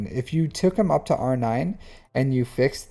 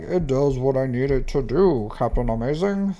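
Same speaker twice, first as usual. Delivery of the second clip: imagined viewer voice. Pretend. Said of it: "that's how I imagine you talk".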